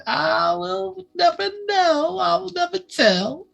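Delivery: high teasing singsong. Real, not acted. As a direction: sing out the secret coyly.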